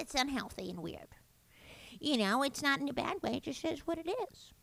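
hint of the easygoing mother; soft mock mom voice; mom impression. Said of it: "And she said".

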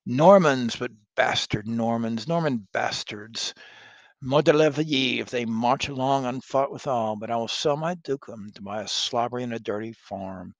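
loud scornful outburst; furious duke. Acted. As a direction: spit out the insult. Brittany rails.

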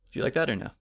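usual speaking voice

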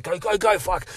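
high, rushed commands; urgent. Retold or imitated. Narrator hurries the group out, urgent and high. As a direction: hurry everyone out.

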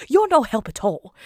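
nanny scolds the giggling Bert, loud mock scolding; exasperated scold. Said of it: "Mary Poppins is like".

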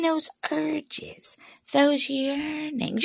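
slow breathless whisper. Acted. Comic to the audience, slow suggestive whisper. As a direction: hush it like a dirty secret. Leering aside about teen urges.

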